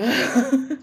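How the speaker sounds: loud, high burst of laughter. Real, not acted. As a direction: cackle out loud. Laugh after insult joke.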